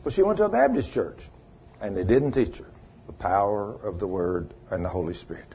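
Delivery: ordinary talk, nothing marked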